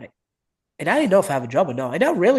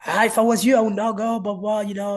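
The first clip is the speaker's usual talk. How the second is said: retold advice; 6 semitones higher than usual. Retold coaches advising against going.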